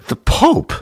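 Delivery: very high squeaky Pope; mock Pope squeak; Pope impression. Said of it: "that's my impression of, uh, the Pope".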